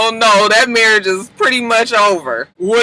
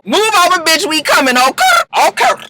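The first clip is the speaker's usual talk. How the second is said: sassy catchphrase; 10 semitones higher, 3 dB louder than usual. Retold or imitated. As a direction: toss it off with attitude. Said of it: "I can't even say, "Okay, okay".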